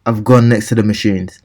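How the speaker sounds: ordinary talk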